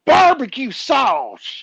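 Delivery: drawn out, high wrestler drawl; wrestler catchphrase voice. Retold or imitated. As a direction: stretch it like a ring announcer.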